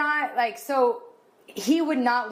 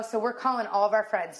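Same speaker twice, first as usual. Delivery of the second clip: ordinary talk, nothing marked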